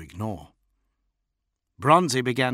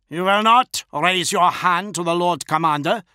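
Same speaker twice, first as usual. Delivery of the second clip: higher, louder stern threat; 9 semitones higher, 7 dB louder than usual. A narrator's reading. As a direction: threaten coldly but loud. Bodyguard choking officer.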